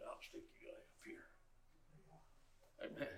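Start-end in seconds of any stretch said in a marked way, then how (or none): 0.1-1.2 whispered aside to the book. Retold or imitated. Preacher whispering to a book he puts away. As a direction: breathe it to the book you're shelving. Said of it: "and I'll say".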